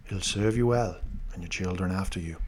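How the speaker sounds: lightly voiced loyal knight, low and softened; Jorah Mormont impression. Host quotes the knight's line to co-host, light impression. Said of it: "good impression by me".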